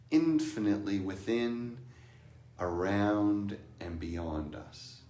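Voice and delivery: slow, hushed contemplative voice; meditative reflection. Acted. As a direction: let each phrase settle softly.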